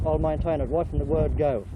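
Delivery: normal talking voice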